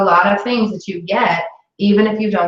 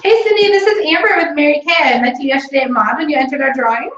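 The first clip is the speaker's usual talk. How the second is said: high, bright, eager phone voice; bubbly sales call; 7 semitones higher than usual. Shown as a demonstration. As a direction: sell it with big cheerful enthusiasm. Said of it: "I wanna hear you again".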